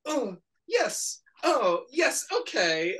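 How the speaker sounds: high, soft, halting exclamations; Ed Wood. Retold or imitated. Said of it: "she's, like, talking like Ed Wood".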